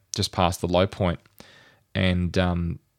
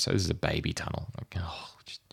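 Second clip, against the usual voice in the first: whispered aside; 9 dB quieter than usual. Retold or imitated. Repeating the tunneler's brag.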